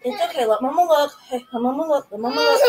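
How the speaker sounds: soothing, high comforting; calm the hurt little one; soothing a scared dog. Real, not acted.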